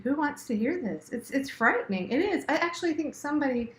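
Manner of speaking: ordinary talk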